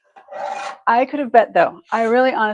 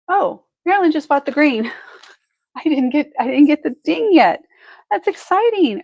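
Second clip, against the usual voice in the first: higher, louder, thrilled burst with a laugh; excited; 5 semitones higher, 6 dB louder than usual. Real, not acted. Host thrilled that viewer Marilyn bought the green piece, excited and laughing. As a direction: gush with delight over the sale. Buyer Marilyn just purchased.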